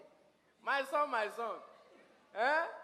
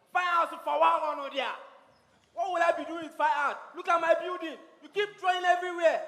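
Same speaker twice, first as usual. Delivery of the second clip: furious shout; 6 semitones higher, 10 dB louder than usual. Acted. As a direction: rage at the idea of art. Father shouting about fine arts.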